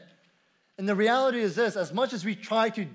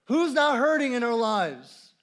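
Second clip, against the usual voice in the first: ordinary talk, nothing marked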